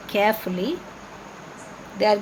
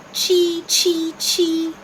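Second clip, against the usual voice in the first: high chirp imitation; chirping bird call; 6 semitones higher, much slower than usual. Shown as a demonstration. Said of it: "Can you tell me some more sounds of birds?".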